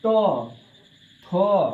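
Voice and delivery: showing the dental sounds; tooth sound demo. Shown as a demonstration. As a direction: hit तो, थो firmly at the teeth. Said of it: "जैसे हम बोले".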